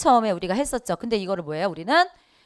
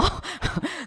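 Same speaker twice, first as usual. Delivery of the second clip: laugh; 9 semitones higher, 8 dB quieter than usual. Real, not acted. Teacher laughs at own joke.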